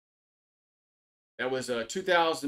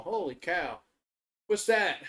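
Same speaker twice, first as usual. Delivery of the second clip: ordinary delivery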